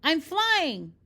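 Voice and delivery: loud, high cry of joy; excited shout. Acted. Bernard shouts to everyone, thrilled he's flying. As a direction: shout it like you've finally taken off. Bernard thinks he flies at last.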